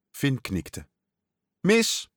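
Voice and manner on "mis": high, gleeful gotcha call; triumphant father catching him out. A narrator's reading. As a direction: call it out sharply, pitched way up. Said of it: "riep zijn vader".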